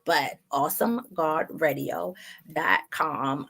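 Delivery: normal talking voice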